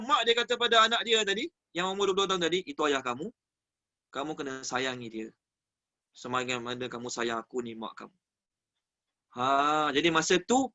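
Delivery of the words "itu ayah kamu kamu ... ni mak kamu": low, soft voice of a mother advising; hushed, unhurried motherly advice; gentle motherly counsel. Acted. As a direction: speak softly and low, easing off toward the end. Mother gently counselling her son.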